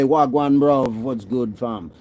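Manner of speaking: a light Jamaican patois greeting, "Wagwan" said casually; Jamaican accent. Shown as a demonstration. Streamer showing chat his practice Jamaican accent, light and easy. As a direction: try a hint of Jamaican slang, easygoing. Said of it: "working on my accent so I can say".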